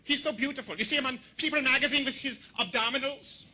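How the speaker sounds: gushing mock German woman; hammed-up German admirer; German accent. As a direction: gush like a German liberal, accent thick. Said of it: "She's like".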